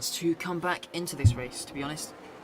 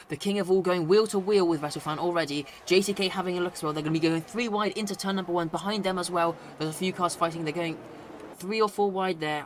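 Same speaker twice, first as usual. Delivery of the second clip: louder, higher, rushing race call; excited play-by-play; 3 semitones higher, 5 dB louder than usual. Acted. Race start live commentary.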